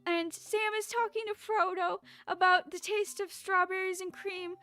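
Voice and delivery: theatrical lament over a sad movie; mock sad. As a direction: plead as if pretending to be close to tears. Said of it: "when I get a little sad".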